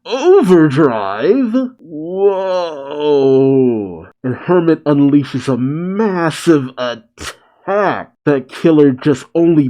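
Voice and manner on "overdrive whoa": drawn-out shocked disbelief; slow, stunned exclamation. A narrator's reading. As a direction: stretch the words out in acted shock. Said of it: "Killer's shocked as he says".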